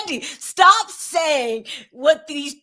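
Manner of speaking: loud, slow exasperated plea; playful, high-pitched telling-off; mock scolding. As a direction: call him out with drawn-out emphasis. Scolds absent TV host.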